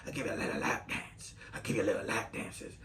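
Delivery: put-on whispered jealous older coworker; whispered smoker voice. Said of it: "she talks like this 'cause she smoked a lot of cigarettes".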